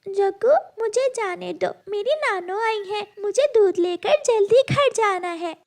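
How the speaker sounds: put-on high childlike pleading voice; little girl voice. (ऊँची आवाज़ में छोटी बच्ची)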